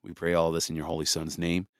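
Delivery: earnest spoken prayer to God. Real, not acted. Leads a prayer.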